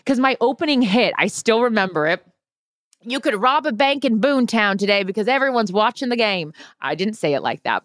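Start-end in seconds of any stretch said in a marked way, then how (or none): 3.0-6.5 higher-pitched small-town App State twang; hammed-up local twang from App State. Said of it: "give a little App State twang".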